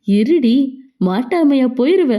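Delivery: ordinary delivery, nothing marked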